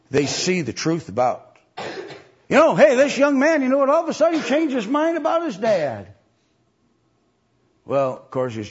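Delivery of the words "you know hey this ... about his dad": mock excitement. Acted aside mocking easy change.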